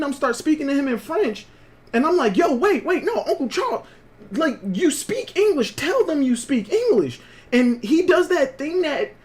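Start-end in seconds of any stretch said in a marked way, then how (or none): 2.4-7.2 urgent pleading. Retold or imitated. Urging uncle to admit English.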